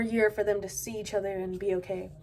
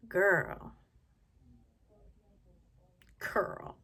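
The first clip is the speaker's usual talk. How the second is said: knowing disbelief. Retold or imitated. Reacting to grandma's excuse.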